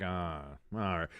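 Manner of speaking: quiet, low letdown; deflated. Retold or imitated. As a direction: sigh it out, resigned and softly. Dejected reaction to no news.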